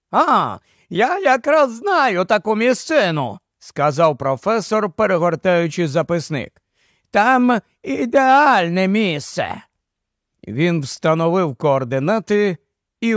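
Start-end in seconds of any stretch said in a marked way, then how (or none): 0.1-3.4 higher-pitched professor voice; lightly voiced professor, pitched up. Professor offers Barney a place he knows, higher character voice. 7.1-9.6 slow, higher-pitched professor voice. Acted. Professor recommends a landing place, slow character voice.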